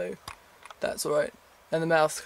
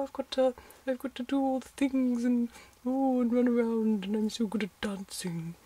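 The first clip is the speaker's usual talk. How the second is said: stupid Victoria voice; 9 semitones higher than usual. Pretend. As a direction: play the sweet innocent heroine, hammed up. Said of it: "talking in this stupid voice".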